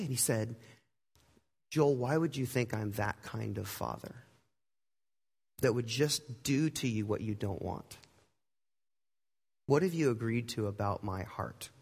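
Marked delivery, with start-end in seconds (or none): 1.7-11.7 low, soft, measured questioning; gentle fatherly reproach. Retold or imitated. God as father questioning his doubting son, gently. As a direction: ask softly and low, with room between questions.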